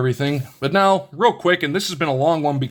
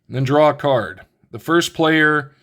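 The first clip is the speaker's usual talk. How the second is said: ordinary delivery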